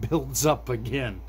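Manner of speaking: normal talking voice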